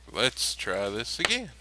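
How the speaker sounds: ordinary talk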